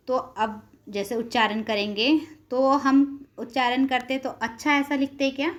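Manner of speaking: ordinary delivery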